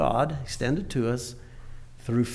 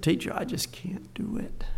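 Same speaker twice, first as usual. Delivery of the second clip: put-on whispered, higher, small child's plea; a crying child whispering that she can't; child's tearful whisper; 5 semitones higher, 6 dB quieter than usual. Crying first grader voiced in story.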